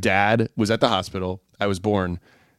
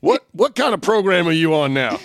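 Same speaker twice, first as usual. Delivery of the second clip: nagging parent impression; 8 semitones higher, 3 dB louder than usual. Imitating confused dad.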